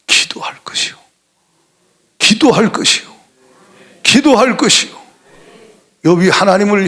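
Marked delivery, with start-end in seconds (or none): 0.0-1.0 hushed answer. Shown as a demonstration. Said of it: "뭐 하라는 거예요?". 4.0-5.0 firm proclamation. Shown as a demonstration. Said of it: "뭐 하라는 거예요?".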